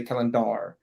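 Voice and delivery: "calendar" misstressed as calen-DAR; full-vowel DAR closing "calendar"; stress on last syllable. Shown as a demonstration. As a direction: put the weight on the end of "calendar". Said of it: "We don't say calendar".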